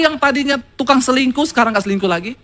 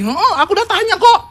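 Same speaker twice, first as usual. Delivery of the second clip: laughing through the words, a little higher; laughing reply; 5 semitones higher than usual. Retold or imitated. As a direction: say it with a light laugh. Retold reply to husband, laughing.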